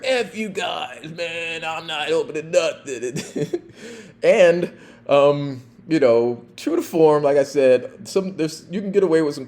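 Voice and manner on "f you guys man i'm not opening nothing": high, slow slurred shout; drunk refusal. Retold or imitated. Drunk suspect to police, slow mock shout. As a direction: sneer it like a drunk who won't budge.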